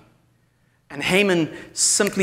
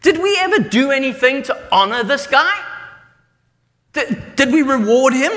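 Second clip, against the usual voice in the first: a king sitting up in bed, realizing; startled king; 8 semitones higher than usual. Acted. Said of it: "kind of goes".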